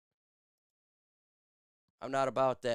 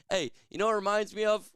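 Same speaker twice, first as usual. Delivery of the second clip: normal talking voice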